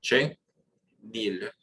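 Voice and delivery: "che" then "nil" apart, no nasal vowel; split syllables. Shown as a demonstration. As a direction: pause between "che" and "nil", plain oral vowel. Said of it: "literally split out by".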